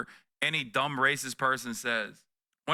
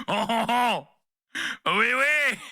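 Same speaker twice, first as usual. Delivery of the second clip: much higher, louder, hammed-up French "oui, oui"; cheerful caricature of a French voter; mock French; 9 semitones higher, 5 dB louder, much slower than usual. Mocking French voters with a jokey French accent.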